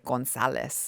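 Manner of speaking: mock Spanish pronunciation of Gonzalez; lightly Spanish Gonzalez. Shown as a demonstration.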